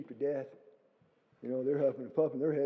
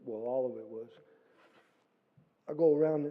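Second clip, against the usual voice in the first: ordinary delivery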